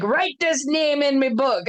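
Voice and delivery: slow, mock Irish accent giving an order; a hint of Dad's Irish brogue, drawn out; terrible Irish accent. Retelling Dad's order to write the name, in a mild Irish accent. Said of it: "there's gonna be a lot of terrible Irish accents".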